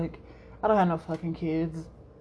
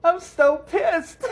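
much higher and louder, half-laughing fake rage; mock pissed outburst; 9 semitones higher, 6 dB louder, much slower than usual. Retelling waking up angry at the anime, hammed-up higher louder rage. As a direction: ham up waking up still furious. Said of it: "I was like".

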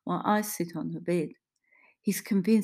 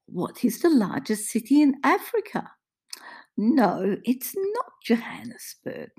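high, loud sing-song condescension; overdone quizmaster lilt for the elderly; patronizing carer; 6 semitones higher, 5 dB louder than usual. Acted. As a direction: talk down brightly, as to small children. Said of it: "recognizing the patronizing tone".

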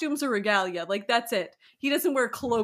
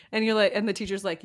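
ordinary talk

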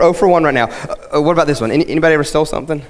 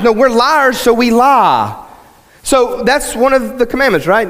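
fervent sermon climax; preaching at full force; 6 semitones higher than usual. Acted. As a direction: drive it home from the pulpit.

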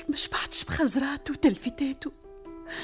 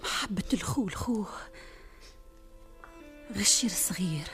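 whispered lament; 5 dB quieter than usual. Acted. Princess whispers of stifled love.

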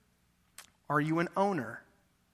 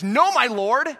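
loud, higher protest; an old woman's loud, disbelieving objection; objecting loudly; 4 semitones higher, 6 dB louder than usual. Acted. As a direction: push back hard on the prophet. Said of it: "And her response is".

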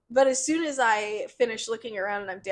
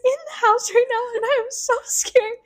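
panicked; 13 semitones higher, 5 dB louder than usual. Retold or imitated. Panicked call to boyfriend retold.

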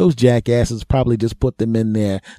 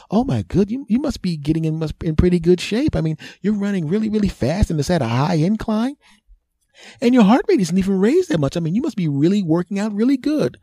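much higher gushing women's voice; admiring women; 10 semitones higher than usual. Retold or imitated. Women on treadmill praising runner, high gushing voice. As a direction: coo at him like impressed women.

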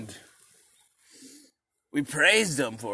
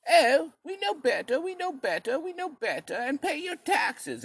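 high, softening smug chant; smug sing-song demand; snooty British; 12 semitones higher, 4 dB quieter than usual. Retold or imitated. The British demanding taxes, mock snooty voice. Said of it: "the British were like".

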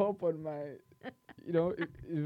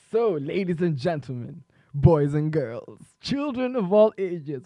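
slow announcer build-up; drawn-out ringmaster call; grand entrance announcement; 4 dB louder, slower than usual. Acted. Radio host announces giveaway.

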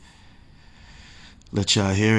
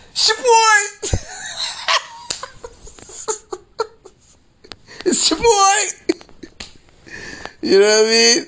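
excited hype yelling; 14 semitones higher, slower than usual. Real, not acted. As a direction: hype yourself up, cracking up as you yell. Hyping himself up, laughing.

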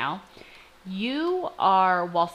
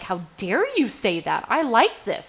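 mock offended; 6 semitones higher than usual. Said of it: "I wanna be offended and be like".

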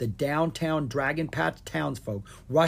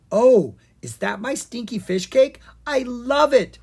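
high, loud delighted squeal; 10 semitones higher, 9 dB louder than usual. A narrator's reading. Pixie fluttering over to a cake, squealing. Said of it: "Penelope Pepperpie squealed".

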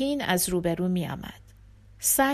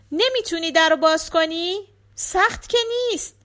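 put-on little girl echoing a question; high child asking back; 8 semitones higher, 5 dB louder than usual. A narrator's reading. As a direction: echo father's question as a small girl. Daughter voiced, repeating father.

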